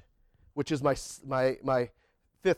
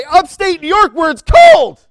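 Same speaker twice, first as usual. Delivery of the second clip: slow, much higher and very loud, a booming verdict; God's answer shouted; 11 semitones higher, 17 dB louder, slower than usual. Retold or imitated. Preacher voicing God's reply to the eager servant, slow loud shout. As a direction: shout it out like a punchline from heaven. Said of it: "'cause he's gonna say".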